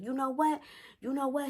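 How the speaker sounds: high-pitched warning before a rant; imitated stepdad losing patience; fed up. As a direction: pitch it up, indignant. Stepfather's retold rant.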